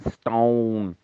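"stone" with a long broad Mancunian vowel. Shown as a demonstration. Caller shows the Mancunian word to Scotty, drawn-out Mancunian "stone". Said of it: "Mancunian accent when it comes to rocks".

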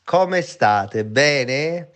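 ordinary delivery

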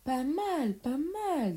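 high, stretched-out words through a yawn; bored, yawny drawl. Acted. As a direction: yawn your way through faint praise. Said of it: "dit Pierre en bâillant".

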